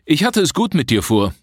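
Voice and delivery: ordinary delivery, nothing marked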